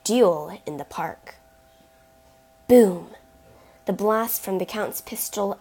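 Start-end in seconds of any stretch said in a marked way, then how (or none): 2.7-3.1 booming gunshot cry; loud boom. A narrator's reading. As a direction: shout the blast. Gunshot sound effect read aloud.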